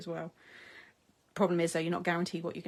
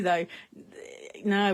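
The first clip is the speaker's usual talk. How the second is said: usual speaking voice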